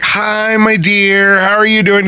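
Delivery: high, camp flirty greeting; flamboyant flight attendant. Retold or imitated. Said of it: "He does it so well".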